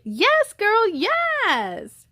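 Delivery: high, excited shout of approval; blurted cheer. Acted. Girl cheering a classmate in class, excited blurt. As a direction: hype up a friend, blurting. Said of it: "Shea Butter blurted".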